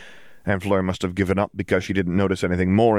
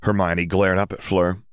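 usual speaking voice

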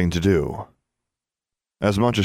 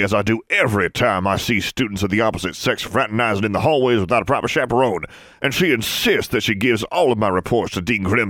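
put-on prissy old wizard; 4 semitones higher than usual. Wizard's prissy gossiping rant.

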